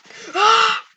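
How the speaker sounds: loud high shouted gasp; excited gasp. Real, not acted. Surprised by game event.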